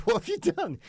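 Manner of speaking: retold panic at a lost turbot; shocked shout. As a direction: yell it in disbelief. Shocked reply to client.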